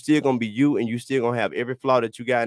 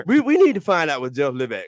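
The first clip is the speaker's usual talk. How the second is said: laughing; 7 semitones higher, 5 dB louder than usual. Real, not acted. Laughing while teasing friend.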